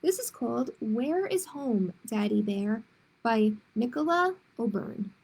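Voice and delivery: ordinary delivery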